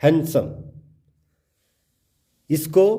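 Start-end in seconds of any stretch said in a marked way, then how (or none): 0.0-0.5 हैंडसम said as "han-sum"; हैंडसम with n going straight to s; silent D. Shown as a demonstration. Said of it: "हैंडसम बोलते हैं".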